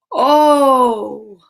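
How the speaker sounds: plain reading voice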